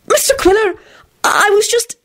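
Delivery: high-pitched flustered stammer. A narrator's reading. Secretary caught off work by her boss, flustered. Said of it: "Bea flushed furiously".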